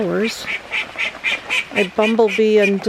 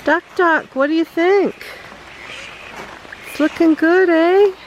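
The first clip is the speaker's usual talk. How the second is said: high, sing-song pet talk; 5 semitones higher than usual. Real, not acted. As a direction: coo at the ducks like babies. Cooing at the ducks.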